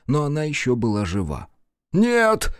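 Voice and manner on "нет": shout. A narrator's reading. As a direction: shout him down before he heals her. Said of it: "крикнул Фоя".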